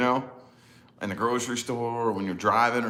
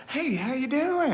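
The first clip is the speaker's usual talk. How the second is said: much higher, slow cheery greeting; chatty stranger greeting; slower than usual. Retold or imitated. Gas station stranger greeting, high and slow. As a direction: sing it out like an overfriendly stranger. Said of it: "They'll be like".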